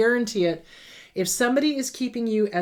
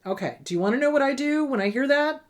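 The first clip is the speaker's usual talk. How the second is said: ordinary delivery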